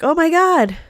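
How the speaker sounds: high, loud mock shock; shocked gasp. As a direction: act out stunned surprise. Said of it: "like they're not saying".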